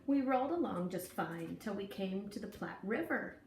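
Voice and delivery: usual speaking voice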